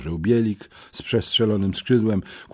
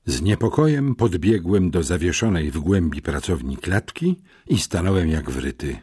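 ordinary delivery

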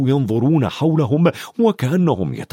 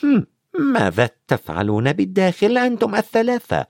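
questioning teacher; 7 semitones higher than usual. A narrator's reading. Teacher questions three pupils indoors.

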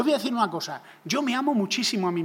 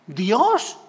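a mild mock of a proud secular thinker, loud; 3 semitones higher, 6 dB louder than usual. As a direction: scoff at God, crowning man. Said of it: "una mente secular". (la mente secular burlándose de Dios, en voz alta)

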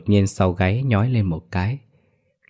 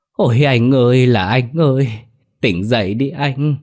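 crying lament; 5 semitones higher than usual. A narrator's reading. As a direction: wail over a fallen brother in mock grief. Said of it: "vừa khóc vừa dùng vạt áo".

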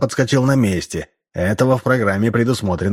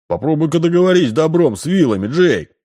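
scoffing; 8 semitones higher than usual. A narrator's reading. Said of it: "хмыкнул Эбнер".

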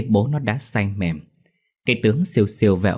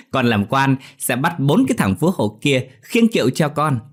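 boastful; 6 semitones higher, 3 dB louder than usual. A narrator's reading. Boasting after laugh.

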